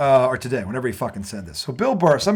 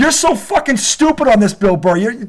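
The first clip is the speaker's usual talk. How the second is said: higher and louder furious tirade; angry rant; 8 semitones higher, 5 dB louder than usual. Real, not acted. Host rants at Bill Burr over Belichick, higher and louder. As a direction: tear into him, voice rising.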